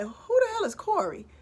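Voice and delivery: high, startled who-the-hell; baffled. Retold or imitated. Mom stunned cops call her man Corey. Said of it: "I'm looking around like".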